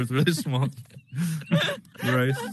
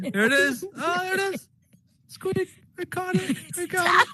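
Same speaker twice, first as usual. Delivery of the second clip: very high, thin squeak of a voice; squeaky high voice. Real, not acted. Host squeaking high to make the device squeak again. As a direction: pitch way up to coax the squeak. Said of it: "If I keep talking like this".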